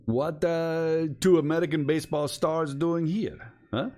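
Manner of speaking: put-on high, loud Russian accent. Stranger quizzes two American baseball stars, loud high Russian accent. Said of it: "I'm doing a Russian accent".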